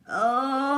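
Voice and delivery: high, loud cry of pain; a hurt creature crying out; pained groan. A narrator's reading. Crocodile in terrible pain, groaning aloud. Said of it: "Something was in terrible pain".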